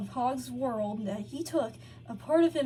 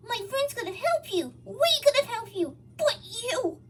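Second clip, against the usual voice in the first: high, raised pleading voice; Hog pleading; 12 semitones higher, 6 dB louder than usual. A narrator's reading. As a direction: plead with him, voice pitched up. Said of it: "he explained".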